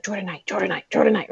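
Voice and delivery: soft repeated chant to oneself; nervous self-rehearsal. Retold or imitated. Fan rehearsing before meeting her idol, nervous and hushed. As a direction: rehearse it under your breath.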